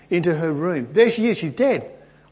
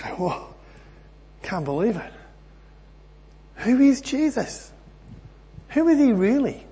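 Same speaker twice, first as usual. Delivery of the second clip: stunned awe; 8 semitones higher than usual. Pretend. Peter watching the girl rise, stunned and awed. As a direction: gasp it out like a man who just saw the dead rise. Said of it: "I think I'd just fall over backwards".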